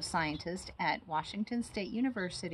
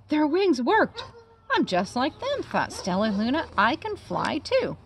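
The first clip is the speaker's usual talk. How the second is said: put-on excited baby bat; 7 semitones higher, 8 dB louder than usual. Bat thrilled she can fly.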